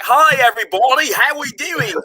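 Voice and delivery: bad imitation of a Londoner, like a cartoon; exaggerated high-pitched English accent; cartoonish English accent. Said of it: "I sounded like my favorite cartoon".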